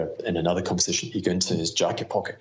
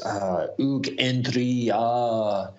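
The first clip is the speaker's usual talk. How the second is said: put-on slow, higher French-accented greeting; a hint of a French accent, raised and slow; 4 semitones higher, slower than usual. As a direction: play up a French accent, drawn out. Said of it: "he puts on this French voice".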